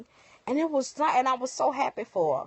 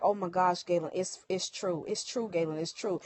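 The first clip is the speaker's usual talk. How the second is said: stunned disbelief; 5 dB quieter than usual. Retold or imitated. Seeing police lights at her house.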